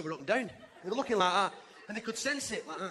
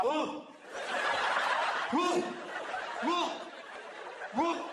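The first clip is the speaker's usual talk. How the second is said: rising mock shiver "whoa"; coming-in-from-rain "whoa"; 5 semitones higher, much slower than usual. People coming in out of rain, rising mock "whoa". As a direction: shake off the wet, breathy rise. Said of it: "Making that noise".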